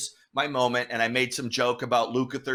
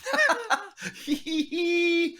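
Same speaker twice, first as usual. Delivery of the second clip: big laugh. Real, not acted. Laughing at the memory.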